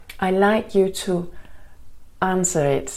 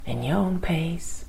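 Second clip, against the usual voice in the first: guided meditation. Acted. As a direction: ease them into calm, gently.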